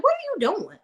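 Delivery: high-pitched sassy receptionist voice; imitating a nosy coworker, high and sassy. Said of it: "She's like".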